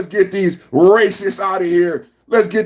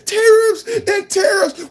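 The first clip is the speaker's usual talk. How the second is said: mocking critics; 12 semitones higher than usual. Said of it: "And they keep saying".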